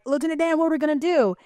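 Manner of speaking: high-pitched worried plea; imitating frightened Forrest. Forrest asking Lieutenant Dan for help in the storm, mock fearful. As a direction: plead up high like a scared shipmate. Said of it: "Forrest is afraid, and he's like".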